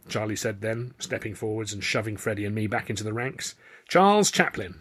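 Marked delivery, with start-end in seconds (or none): 3.9-4.8 much higher, louder flourish; grand self-introduction. A narrator's reading. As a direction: present yourself with a flourish. Charlie introducing himself.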